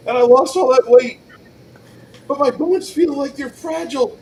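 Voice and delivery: high, loud, whining complaint; whiny ogre. Acted. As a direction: plead like a wounded giant.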